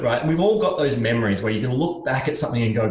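normal talking voice

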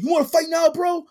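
loud, high mock taunt of a drunk picking a fight; hammed-up drunk bro wanting a fight; drunk challenge. Retelling a shirtless drunk friend challenging the Mexican dude, loud mock taunt. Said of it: "He's like".

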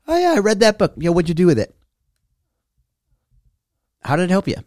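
high, airy voice of a casual bragger; mock smug reader. Mocking a brag being questioned.

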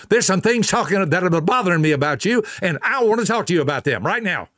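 sharp confrontational nag; harsh tone. Shown as a demonstration. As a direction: fire it at them like a complaint. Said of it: "See the difference?".

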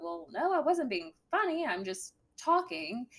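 ordinary talk

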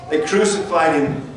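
ordinary talk

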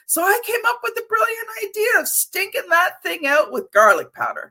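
high, lively, full of excitement; excited storytelling. Real, not acted. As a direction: tell it like it's the best idea ever. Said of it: "exactly how you just said it, with all the intonations, all the excitement".

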